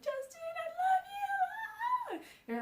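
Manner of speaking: high-pitched, drawn-out mock fangirl scream; crying fan scream. Fan screaming at the singer on first sight, mock tearful scream. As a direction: act out a tearful scream, stretched long and very high. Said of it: "I'm, like, screaming".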